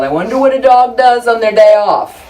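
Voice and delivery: hammed-up comedian quote, punched out; Carlin-style joke delivery. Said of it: "George Carlin's joke".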